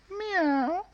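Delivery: very high meow; cat meow. A narrator's reading. Animal filter.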